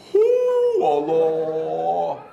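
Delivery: loud, drawn-out wail; mock bawling. Imitating the warrant officer crying at prayer, comic wail. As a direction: bawl out to God, exaggerated. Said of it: "Pegawai Warren teriak".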